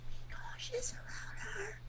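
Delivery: breathless voiceless whisper of a warning. Acted. Electronic warns the new guy about Alisa, whispering. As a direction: lean in and breathe it, no voice at all. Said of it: "He was speaking in a whisper".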